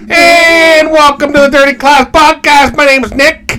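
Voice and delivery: high, stretched-out announcer welcome; hammed-up podcast host intro; fresh show intro. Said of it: "treat it like it's a new one".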